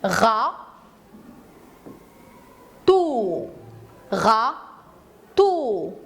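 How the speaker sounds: Ra- and tôt split apart with long pauses; teacher counting syllables with the class. Shown as a demonstration. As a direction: beat out Ra-, tôt twice, slowly. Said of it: "Voyons ensemble".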